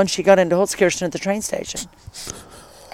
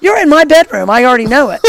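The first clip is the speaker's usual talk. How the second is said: higher, louder retold amazement; excited reveal; 5 semitones higher, 6 dB louder than usual. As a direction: burst out with the coincidence. Retells his excited reveal to her.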